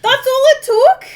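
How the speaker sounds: incredulous shout. Real, not acted. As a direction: shout it in disbelief. Outraged at friend's game choice.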